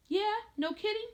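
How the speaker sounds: very high-pitched, cheeky retort; provoking back talk. A narrator's reading. Teenager answering father's threat, high cheeky retort. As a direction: toss it back high, needling him. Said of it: "it was just the way I said".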